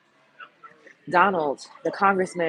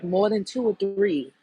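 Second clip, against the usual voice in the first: ordinary talk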